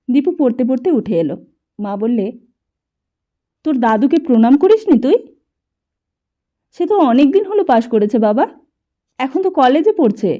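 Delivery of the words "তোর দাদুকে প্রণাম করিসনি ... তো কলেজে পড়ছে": loud, higher urgent explaining; a mother hurrying to smooth things over; mother urging the son and soothing the grandfather; mother pleading loudly. A narrator's reading. Mother calls Dipu, placating.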